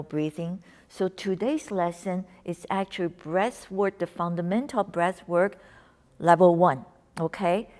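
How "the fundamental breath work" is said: normal talking voice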